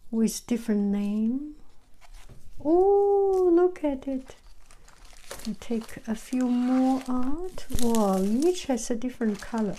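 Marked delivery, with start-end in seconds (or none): 2.6-4.4 a high, loud, thrilled ooh; a real squeal of joy; delighted ooh. Real, not acted. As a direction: gasp with real delight at the pretty tubes. Delighted opening the sugar tubes.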